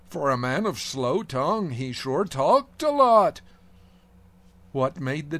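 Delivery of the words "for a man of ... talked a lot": higher, louder, slowing punchline quip; quoting Fiddler on the Roof. Retold or imitated. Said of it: "as the line from Fiddler on the Roof says".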